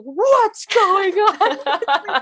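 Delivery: high, loud, very slow alarmed call; alarmed Phil. Retold or imitated. Phil rushing in, calling out in alarm. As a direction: drag it out, alarmed. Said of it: "Phil, like, flies out and he's like".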